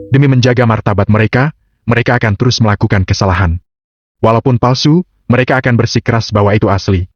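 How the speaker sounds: plain reading voice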